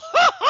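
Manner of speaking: big shouted laugh at his own joke; loud shouted laugh; loud burst of laughter. Real, not acted. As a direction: crack up loudly.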